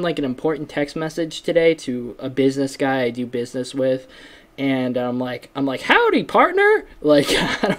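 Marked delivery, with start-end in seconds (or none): 5.8-6.9 high, laughing Texan cowgirl howdy; Sandy Cheeks Texan. Retold or imitated. Texting a business guy, in a laughing Texan cartoon voice. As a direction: chirp it like a Texan cartoon squirrel. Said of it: "when I turned into Sandy Cheeks".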